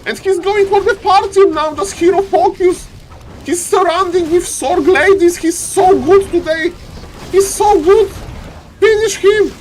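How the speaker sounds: high, loud squealing play-by-play; ecstatic call. Real, not acted. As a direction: scream the finish. Climax of the fight.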